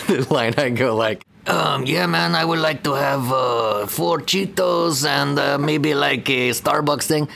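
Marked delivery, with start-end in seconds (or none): 1.5-7.3 higher, goofy drive-through customer voice, slower; silly put-on voice. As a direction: play a clueless stranger ordering. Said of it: "something that's totally not gonna look like me".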